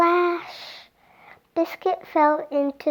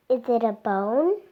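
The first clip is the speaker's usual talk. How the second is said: ordinary delivery, nothing marked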